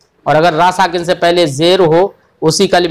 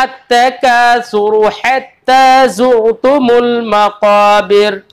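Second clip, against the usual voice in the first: slow chanted verse, stopping on a full रा; Quranic recitation; much slower than usual. Shown as a demonstration. Teacher giving the verse example, slowly recited. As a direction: recite slowly, drawing out मकाबिर. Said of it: "ऐसे हमने कहा".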